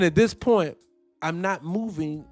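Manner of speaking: ordinary talk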